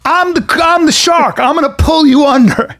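high, emphatic shark boast; mock threat. Retold shark threat to strangers.

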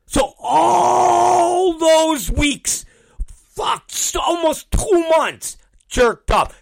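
high, slow, strained yell with a break in the voice; shouting the grievance, voice cracking; real frustrated outburst. Real, not acted. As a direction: let the anger boil over. Venting about weeks of delay.

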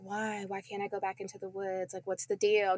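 hushed concern; quiet worried question. Retold or imitated. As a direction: ask dad in a small worried voice. Child asking dad, concerned.

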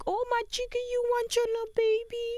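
put-on high cooing baby voice; baby talk. Mom cooing to her little dog, high baby talk.

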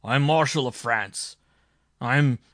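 loud, proud declaration; thundering. A narrator's reading. Marshal asserts his rank to the judge, thundering. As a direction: boom out your rank indignantly. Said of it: "thundered the marshal".

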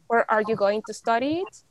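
usual speaking voice

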